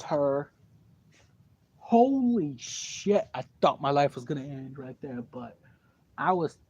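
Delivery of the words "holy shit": higher, drawn-out shocked gasp of words; stunned. Real, not acted. Teller reliving hearing the boy left her, slow and shocked. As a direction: let it out slowly in disbelief.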